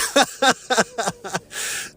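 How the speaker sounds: a high laugh breaking in. Real, not acted. Thanking the universe, high laugh. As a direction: laugh through the mock thanks.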